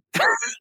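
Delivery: a quick high laugh; short laugh. Real, not acted. After admitting to his wife he'll speak up, laughing. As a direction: laughs at himself.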